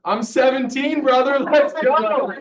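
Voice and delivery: put-on pumped-up young hype, high and loud; high-pitched, bursting with eagerness; fired up. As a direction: cheer it out like an eager teenager. Acts his excited younger self.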